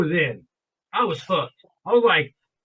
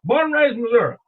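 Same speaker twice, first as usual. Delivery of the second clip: put-on Southern accent with Missouri said as "Missoura"; deep Southern accent; 5 semitones higher, 5 dB louder than usual. Stranger telling diners his origins, imitated loud Southern accent. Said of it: "is what he said".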